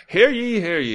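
loud, high town crier call. Pretend. Mock town crier proclamation.

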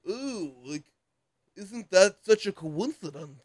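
over-the-top parody British voice, slow; goofy English accent. Retold or imitated. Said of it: "doing the super goofy voice".

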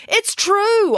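high-pitched insistent protest. A narrator's reading. Mum answering Tammy who does not believe her, insistent.

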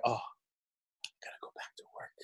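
hushed dread; whispered Sunday-night groan about work. Retold or imitated. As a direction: breathe it like the weekend is dying.

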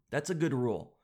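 ordinary talk

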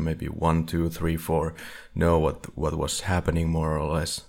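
usual speaking voice

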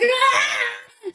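thin high retold shriek; imitated scream. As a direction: act out a contained scream of terror.

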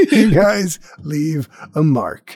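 said through laughter, slowly; laughing out the line again. Real, not acted. Repeated while laughing.

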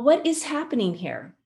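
ordinary talk

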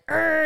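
short loud mock growl of effort; imitating a bodybuilder's flexing grunt. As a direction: grunt like a man flexing in the mirror. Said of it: "he was like".

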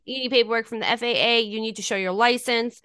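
fast rattled-off requirements; host piling up paperwork rules, fast; rapid list. Acted.

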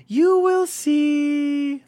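very high, very slow hissing-free creepy drawl; creepy Gollum. Retold or imitated. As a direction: stretch it out eerie and sly. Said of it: "He's like".

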